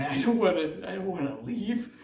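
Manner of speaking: high, pleading voice, lightly done; a hint of a desperate hedgehog; Sonic pleading. Sonic in the garage, torn about leaving Earth, high and pleading. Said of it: "and then he's like".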